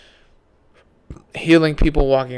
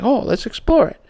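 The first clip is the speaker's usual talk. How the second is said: excited; 8 semitones higher than usual. Retold or imitated. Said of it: "He tries, like".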